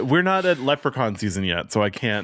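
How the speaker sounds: ordinary talk, nothing marked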